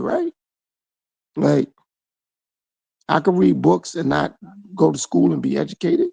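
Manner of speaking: usual speaking voice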